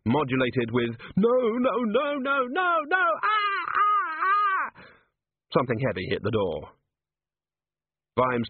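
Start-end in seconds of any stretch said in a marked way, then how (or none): 1.2-4.8 very high wail climbing in panic; high-pitched screaming that builds into wordless cries; terrified scream. A narrator's reading. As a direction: plead in a rising, shrieking wail. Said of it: "and a third scream".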